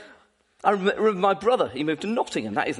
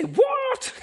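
much higher, a startled mock outburst; shocked what. The congregation reacting to the unfair meal, shocked. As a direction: blurt it out in disbelief.

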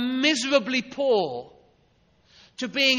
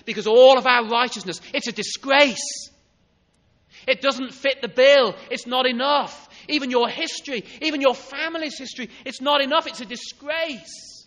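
loud, higher preacher's voice, slowing on disgrace; forceful preaching; 4 semitones higher, 8 dB louder than usual. Acted. Preacher to congregation, insisting loudly that righteousness is not enough.

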